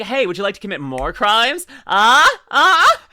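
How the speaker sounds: mock lawyer tempting. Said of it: "then he's like".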